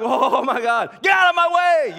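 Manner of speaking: comic road-rage yell, high and loud; laughing angry shout. Retold or imitated. Driver yelling at traffic on eight eighty, mock angry. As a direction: yell at traffic, half-joking. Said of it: "I get angry at people".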